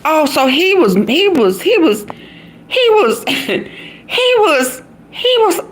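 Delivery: high voice stuck on he was, breaking into laughs; high giggly stammering he was; stuttering disbelief. Retold or imitated. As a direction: keep restarting, cracking up. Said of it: "So then I started saying".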